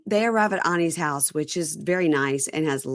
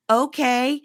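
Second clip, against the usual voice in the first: higher, slow mock broken English; 7 semitones higher, slower than usual. Friend mocking a cast member's broken English accent. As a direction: parody a clumsy foreign English accent. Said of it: "he goes in and out of many accents".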